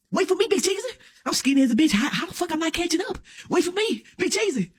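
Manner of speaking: high, pleading call; calling after friend. Retold or imitated. Skinny guy calling after his fast friend, high. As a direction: call after him as he runs off.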